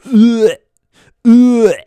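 loud, high-pitched grossed-out groan; exaggerated retching "ugh", twice; mock disgusted "ugh". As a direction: imitate squeamish listeners recoiling loudly. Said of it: "some of you were like".

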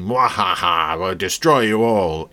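cackling villain. Retold or imitated. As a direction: ham it up like a cartoon baddie. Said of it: "If you'd had those things going".

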